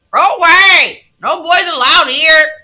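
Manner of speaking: loud, high parrot cry; squawking parrot. A narrator's reading. Parrot calls out at a visiting boy, loud squawk. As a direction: screech it out like an indignant parrot. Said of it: "called out".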